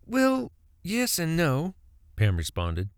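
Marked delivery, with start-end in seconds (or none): 0.0-1.8 slow, drawn-out hedge; slow hedging. A narrator's reading. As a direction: draw it out slowly.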